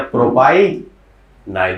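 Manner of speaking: प्रोपाइनाइल drawn out slowly, part by part; teaching pronunciation. Shown as a demonstration. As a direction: stretch प्रोपाइनाइल for students to repeat. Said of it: "कैसे बोलो?". (प्रोपाइनाइल को खींचकर, धीरे-धीरे)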